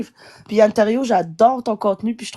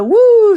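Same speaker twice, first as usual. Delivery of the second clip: exhale; 12 semitones higher, 10 dB louder than usual. Real, not acted. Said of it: "faut que je respire".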